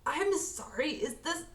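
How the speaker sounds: slow, pained voice of someone stabbed. Acted. Actor reading a line as a stabbed man, pained and slow. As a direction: sound wounded, drawing out the last words. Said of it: "you need to sound stabbed".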